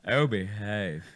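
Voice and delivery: put-on drawn-out catchphrase in a British accent. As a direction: stretch it out in playful British. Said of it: "do it with a British accent".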